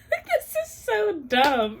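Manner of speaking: slow laughing whine; laugh-crying. Real, not acted.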